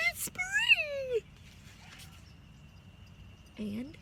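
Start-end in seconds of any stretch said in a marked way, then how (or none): 0.1-1.2 very high, excited squeal; delighted squeal. A narrator's reading. Cat squealing in delight that spring has come, very high. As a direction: squeal it with sudden joy.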